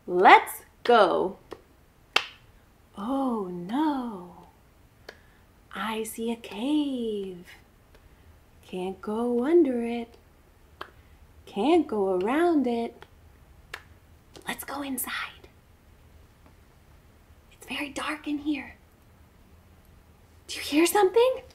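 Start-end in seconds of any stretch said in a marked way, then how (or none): 3.0-13.1 quiet, slow and wary; hushed. Acted. Approaching dark cave. 14.5-18.8 unvoiced cave whisper. Entering dark cave. 20.5-21.4 nervous. Acted. Hearing noise in cave.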